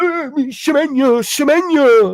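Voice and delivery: high, slow, fretful mock whine over the name; mock nervous fan. Mocking a fan fretting over Semenyo, hammed-up high whine. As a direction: ham up a worried fan fussing about a striker. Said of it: "I'm not gonna be like".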